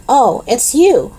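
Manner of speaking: slow, drawn-out, high-pitched cold greeting; frosty teacher's disdain. A narrator's reading. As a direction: greet them icily, taking your time. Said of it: "said Miss Windsor frostily".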